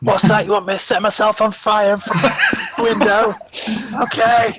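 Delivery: high, loud hard-of-hearing shout; loud, high comic deaf man; deaf stuntman. Acted. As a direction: shout back like you've misheard every word. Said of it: "stuntman who was deaf and kept hearing people wrong".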